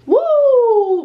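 a sudden high, loud whoop of excitement; excited woo. Real, not acted. Alone on camera, whooping at her red skin, high and loud.

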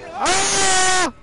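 sudden high loud yelp of fright; startled scream. Real, not acted. Player jump-scared by the game, screaming.

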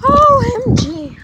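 slow, high, stretched-out letters; drawn-out OMG. Real, not acted. Vlogger freezing on a walk, slow high drawn-out exclaim. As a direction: drag each letter out as the cold bites. Shivering in the cold breeze.

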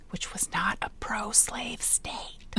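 unvoiced whisper, joking aside. Real, not acted. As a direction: whisper it as a sly side comment. Sly aside about Missouri.

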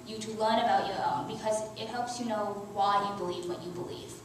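ordinary delivery

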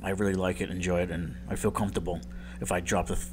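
ordinary talk, nothing marked

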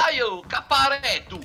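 very high, drawn-out shouted Sardinian parody; mock Sardinian accent. Friends riffing on Sardinia, mock Sardinian yell. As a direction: yell slowly in cartoonish Sardinian.

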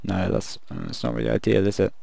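ordinary talk, nothing marked

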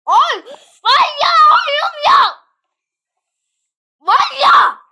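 very high, full-volume screamed lament; anguished scream. Real, not acted. Player reacts to a game disaster, anguished scream. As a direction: scream it in real exasperation.

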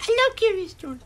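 very high, loud squeal; hammed-up squeal of childish delight; imitating excited child. Retells own childhood reply.

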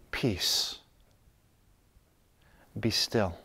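low, soft, settled voice; calm. Acted. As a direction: speak peace gently from inner stillness. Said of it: "you can just calmly say".

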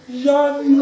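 high, loud call of a name across the neighbourhood; calling Yanni from the balcony; mother calling out. Retold or imitated. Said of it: "και φώναζε".

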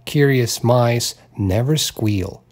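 ordinary delivery

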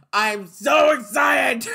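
high, loud, drawn-out cry; excited shout. Real, not acted. Fan gushing about the show, high slow shout. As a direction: stretch it out with joy.

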